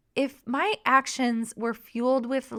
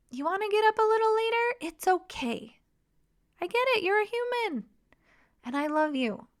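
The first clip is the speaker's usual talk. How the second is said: gentle reassurance; 8 semitones higher than usual. Shown as a demonstration. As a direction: soothe a companion who wants to sleep in. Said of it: "It sounds a little bit like this".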